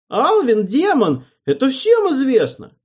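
high, loud accusing shriek; frantic yell. A narrator's reading. Official yelling accusations.

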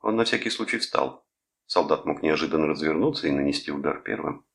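ordinary delivery, nothing marked

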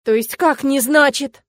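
affronted, drawn-out disbelief; indignant. A narrator's reading. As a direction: question it in outrage. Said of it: "возмутилась Стелла".

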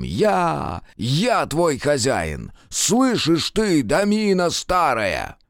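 slow, high, drawn-out yell; wild shout. A narrator's reading. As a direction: roar it at the house. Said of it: "с диким криком".

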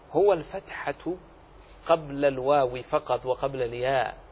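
ordinary delivery

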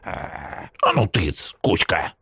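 put-on hushed, slow old woman shooing; old woman shooing dog. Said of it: "сказала бабка собаке".

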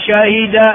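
شهد as shahida; original form. Shown as a demonstration. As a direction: say شهد with a, then i. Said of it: "وكذلك في الفعل". (شهد بفتح الشين وكسر الهاء)

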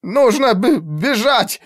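high, desperate stammered plea; stuttered pleading. A narrator's reading. Terrified mage begs commander to run, stammered plea. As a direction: beg to flee, tripping on the word. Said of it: "взмолился Райзер".

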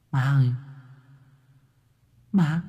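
put-on high, soft, plaintive ghost voice of a dead girl; a drowned girl's voice calling her mother from afar; ghostly daughter calling. A narrator's reading. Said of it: "nghe có tiếng khóc tỉ tê vang lên".

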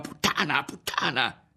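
high, furious cry; narrated shout of rage; screamed insult. A narrator's reading. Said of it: "mentre le gridava".

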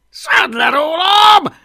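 high, slow, alarmed yell; shouting. A narrator's reading. Man with dragon on his beard, shouting. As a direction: yell it loud, stretching the words. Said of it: "kiáltotta Plépofa".

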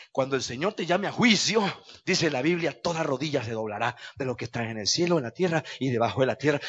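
ordinary talk

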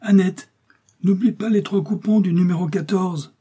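high-pitched holler to someone in another room; calling out. A narrator's reading. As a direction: shout an order through to the shop. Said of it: "il cria dans le judas".

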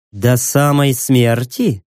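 slow low purr. Acted. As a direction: purr the question menacingly. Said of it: "промурлыкала Кассандра".

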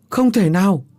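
high, loud cry of denial; desperate shout. Acted. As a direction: scream that it cannot be. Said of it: "hét lên". (ông Tứ hét lên không tin, giọng cao và rất to)

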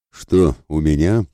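usual speaking voice

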